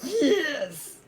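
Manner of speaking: excited laughing yes. Real, not acted. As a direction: burst out with glee. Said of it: "You sound so excited".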